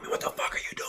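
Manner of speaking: whispered, imitated telling-off; low-volume whispered reprimand in retelling; hushed retold rebuke. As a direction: scold under your breath, as the mentor. Mentor's rebuke retold under his breath.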